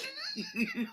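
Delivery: high, soft burst of laughter. Real, not acted. Streamer cracking up at the joke, laughing. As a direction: break into helpless laughter.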